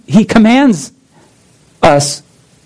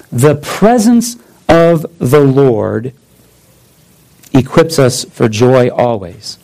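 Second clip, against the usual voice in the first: slow solemn reading; 4 semitones lower, slower than usual. Shown as a demonstration. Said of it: "let me do it this way".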